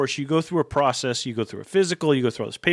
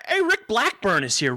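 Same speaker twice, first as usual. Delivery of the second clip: excited greeting; 6 semitones higher than usual. Real, not acted. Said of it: "That is fantastic, Rick".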